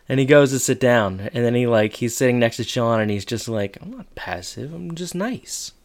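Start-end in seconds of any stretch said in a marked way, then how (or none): 3.8-5.7 very soft, slow, rising at the end; sulky aside. Retold or imitated. Jack sitting next to Sean defending himself, sulky aside. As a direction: say it small, under your breath.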